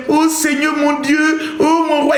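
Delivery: high, slow, hammed-up prayer voice; mock pious prayer. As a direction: parody a churchgoer pleading. Mocking overly pious prayer. (prière exagérée, ton moqueur)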